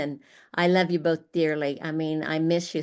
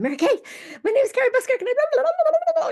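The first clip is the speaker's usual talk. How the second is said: much higher and louder, tumbling out in a breathless rush; giddy rambling fan; 6 dB louder, much faster than usual. Retold or imitated. Meeting Mary Kay, rattling on in a high excited squeal. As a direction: gush like a starstruck nineteen-year-old meeting her idol. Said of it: "I was just rattling on".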